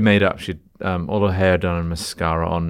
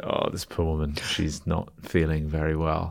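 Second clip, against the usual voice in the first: sympathetic murmur; 3 semitones lower, 7 dB quieter than usual. Retold or imitated. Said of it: "everyone was like".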